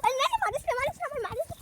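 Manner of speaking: high-pitched, repeated begging; pleading. Real, not acted. Child begs angry girl not to hit, pleading. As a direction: squeal it as if dodging a blow.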